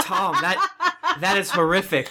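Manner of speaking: horrified words broken by giggles; laughing protest. Real, not acted. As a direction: object while cracking up. Appalled while cracking up at necklace.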